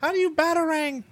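high, put-on clueless kid voice; console kid. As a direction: squeak it out like a baffled young gamer. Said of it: "Those dirty console kids".

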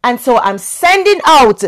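loud, high full-force preaching. Acted. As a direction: preach it out with fire.

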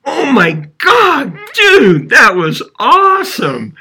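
very high, loud mock-awestruck cry; hammed-up thrilled movie quote, stretching the words; amazed pony guy. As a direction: imitate a guy blown away by a stunt. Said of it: "the pony guy goes".

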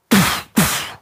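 breathy unvoiced pf, pf of a pistol; whispered gunshot. Mimicking the guard shooting the wounded man, pf pf. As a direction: puff out the shots.

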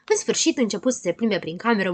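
ordinary delivery

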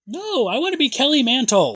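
excited insistence. Real, not acted.

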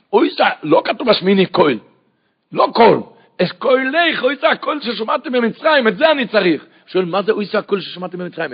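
loud, high-pitched impassioned sermon; preaching at full force. Acted. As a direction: drive the verses home at the top of your voice. (דרשה נלהבת בקול רם)

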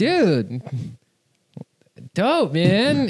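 mock awed dude voice with a pause; impressed fan. Fans admiring the comic, then leaving, impressed.

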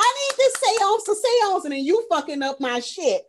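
high, loud indignant complaint; hammed-up griping, pitch starting high and easing down; mock outrage. Host voices the medium guarding her seance, mock indignation. Acts out Joan's indignant outburst.

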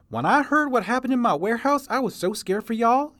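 put-on high, loud folksy old-timer voice; old prospector. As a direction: holler it like a grizzled prospector. Chester speaks.

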